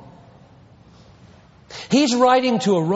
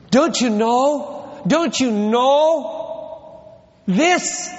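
drawn-out, raised don't you know this; slow, higher appeal to the church; slow pleading question; 6 semitones higher, much slower than usual. Real, not acted. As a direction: stretch it out, pleading. Preacher's closing plea.

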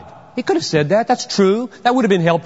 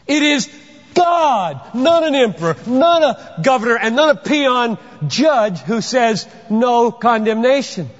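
preaching at full force; 7 semitones higher than usual. Real, not acted. Preacher proclaiming God as the highest court to his congregation. Preaching climax on God's verdict.

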